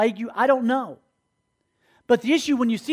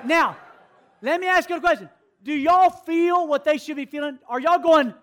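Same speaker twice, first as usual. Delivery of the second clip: ordinary talk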